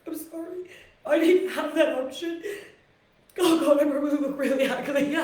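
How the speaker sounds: rough, strained voice, close to tears; choked-up voice after crying. Real, not acted. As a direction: get through it while still choked from crying. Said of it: "God, I sound like an old man".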